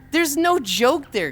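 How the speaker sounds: high, slow, emphatic protest; exasperated. Real, not acted. Streamer insisting to a chatter there's no joke, exasperated. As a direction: stretch it out in exasperation.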